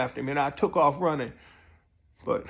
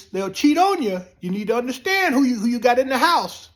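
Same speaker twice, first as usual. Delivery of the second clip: lecturing friend; 8 semitones higher, 6 dB louder than usual. Retold or imitated. Friend giving stern advice.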